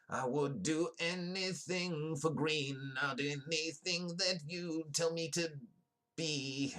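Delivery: high, slow, sing-song sales pitch; silly high shill voice. Pretend. Streamer hamming up a sponsor plug in a high voice. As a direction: croon it like a cheesy jingle.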